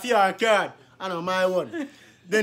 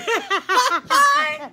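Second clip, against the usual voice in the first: high laugh. Real, not acted. Laughing at his own story.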